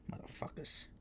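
whispered swearing under the breath; very soft, whispered grumble; muttered curse. Real, not acted. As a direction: curse them in a voiceless aside. Cursing the crew aside.

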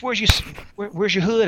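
high-pitched teasing inmate imitation; Love Kills impression. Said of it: "I speak like he speaks".

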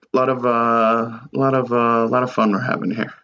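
usual speaking voice

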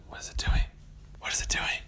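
soft whispered wondering; hushed whisper. Acted. Pirates whispering to each other about the whale, hushed. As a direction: whisper it like watchers keeping hidden.

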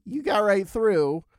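slow, drawn-out complaint; playfully aggrieved, stretching out I couldn't; mock grievance. Real, not acted. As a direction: sulk about being stopped. Complaining to husband.

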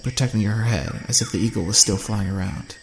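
usual speaking voice

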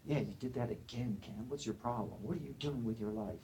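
very soft, hushed inner critic; quiet, insinuating self-talk; nagging inner voice. Acted. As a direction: scold under the breath, like a voice in the head. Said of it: "That voice keeps going".

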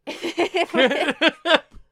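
loud, high burst of laughter; laughing. Real, not acted. Storyteller cracks up after the clammy-hands line, loud laugh. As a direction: crack up at your own performance.

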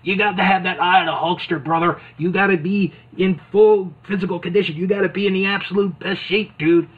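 mock Hulkster pep talk; Hulk Hogan. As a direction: hype him up, brother-style. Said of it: "he's like".

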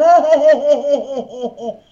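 high laughing jeers, mocking them; a hammed-up imitation of mocking laughter; imitating laughing. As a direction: mimic the guys cracking up in the van. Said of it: "and they're laughing, going".

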